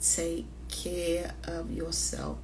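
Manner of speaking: slow soft whisper; whispered advice. Real, not acted. Telling the ladies to take care, slow whisper. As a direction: whisper it slowly like a caring reminder.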